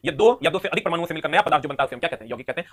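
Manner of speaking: ordinary talk, nothing marked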